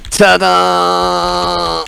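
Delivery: loud, bright ta-da reveal; excited ta-da. Acted. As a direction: belt out a showy ta-da. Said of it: "and then goes".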